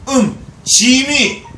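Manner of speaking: high, loud, very slow regal 짐이; king's royal we. Retold or imitated. Preacher imitating an old king saying 짐이 grandly. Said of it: "옛날 임금이 그러잖아요".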